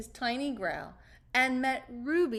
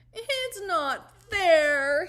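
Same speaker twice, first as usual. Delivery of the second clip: louder, higher, slow wail; 7 semitones higher, 8 dB louder, much slower than usual. Acted. Caterpillar seeing his reflection, wailing slowly. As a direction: stretch it out in a miserable wail. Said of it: "he wailed".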